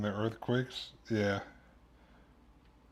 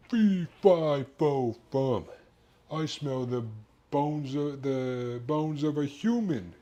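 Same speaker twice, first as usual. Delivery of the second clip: mock giant; 4 semitones higher, slower than usual. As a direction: ham up the Beanstalk giant's fee-fi-fo-fum, slowing at the end. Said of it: "it'll be like Jack and the Beanstalk".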